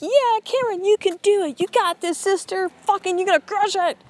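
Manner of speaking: high, excited cheerleader squeal; cheerleader angel. Acted. Shoulder angel cheering on Karen before the fight, high cheerleader pep. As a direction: hype them up like a pep-squad angel.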